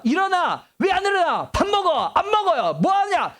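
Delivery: mock yelling to wake the kids; nagging morning yells. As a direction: shout the morning nags like an impatient parent. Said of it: "하루 시작할 때".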